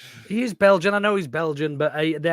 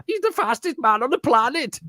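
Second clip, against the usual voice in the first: high, hammed-up German accent; 11 semitones higher than usual. Repeating De Bruyne's line in a mock German accent. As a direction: overdo the German accent again. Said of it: "But in a German accent".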